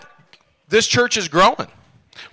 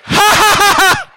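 high, loud "ha ha ha"; forced hearty laugh; 9 semitones higher, 3 dB louder than usual. Shown as a demonstration. Preacher showing online viewers how to laugh, forced and high. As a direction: laugh in big pumping bursts. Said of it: "start laughing at that situation".

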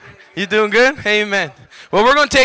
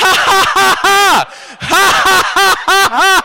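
forced triumphant laugh; 7 semitones higher than usual. Shown as a demonstration. Preacher showing the crowd how to laugh, forced belly laugh. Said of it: "Just start laughing right now".

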